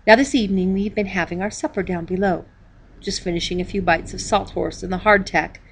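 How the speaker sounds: usual speaking voice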